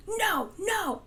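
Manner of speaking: high-pitched sudden "no, no"; mock snap. Speaker acting out snapping at someone who provokes them, mock sudden snap. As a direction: snap back at someone in a flash of anger. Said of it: "you just snap".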